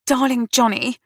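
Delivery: high-pitched, fussing comfort; soothing. A narrator's reading. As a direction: coo to an upset brother. Sister comforts her upset brother.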